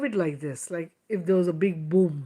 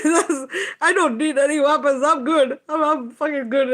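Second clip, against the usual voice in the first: high, loud, laughing brush-off; laughing defiance; 7 semitones higher, 4 dB louder than usual. Retold or imitated. Answering the demons, laughing high and loud. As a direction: toss it off laughing. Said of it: "I'm like".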